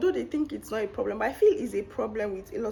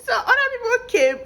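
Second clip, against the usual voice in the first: excited; 14 semitones higher, 7 dB louder, faster than usual. Real, not acted. Excited retelling.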